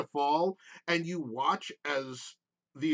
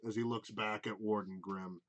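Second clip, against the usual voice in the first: ordinary delivery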